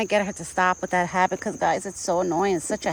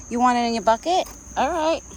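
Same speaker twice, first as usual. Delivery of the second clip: higher, louder coaxing for an animal; pet talk to deer; 6 semitones higher, 5 dB louder than usual. Real, not acted. Woman offers acorns to the deer, higher coaxing pet voice. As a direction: coax the deer toward his bucket.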